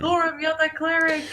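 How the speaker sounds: calling out in play. Pretend. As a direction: call urgently to the cleric, half-joking. Burning player calls out to the cleric.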